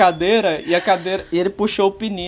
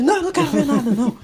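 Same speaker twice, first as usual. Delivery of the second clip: high, loud frightened refusal; mock panicked no, pitched high and loud; scared refusal; 8 semitones higher, 3 dB louder, faster than usual. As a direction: imitate a terrified dad refusing to look. Dad refusing to look.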